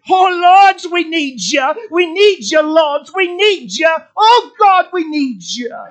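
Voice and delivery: loud, high, pleading cry; crying out to God. Retold or imitated. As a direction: imitate a man calling out to God. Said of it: "crying out to God".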